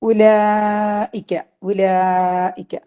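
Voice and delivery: slow, drawn-out chanted recitation; slow tajweed chant; Quran recitation. Shown as a demonstration. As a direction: recite it slowly, stretching each vowel. Tajweed teacher reciting Quran word.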